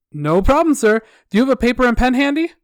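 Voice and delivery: loud, high, quick customer service cheer; sickeningly positive. Acted. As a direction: breeze on as the unshakably chirpy rep. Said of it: "my sickeningly positive customer service attitude".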